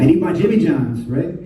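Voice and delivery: ordinary delivery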